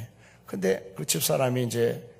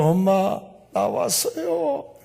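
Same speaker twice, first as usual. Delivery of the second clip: excited daughter; 8 semitones higher, 4 dB louder, much slower than usual. Retold or imitated. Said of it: "돌아보지도 않던 어머니가".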